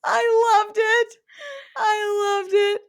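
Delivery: very high, loud, stretched-out squeal; gushing delight. Real, not acted. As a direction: gush it slowly at the top of your voice. Host gushing over the sarcastic retort.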